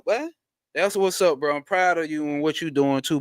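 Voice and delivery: ordinary delivery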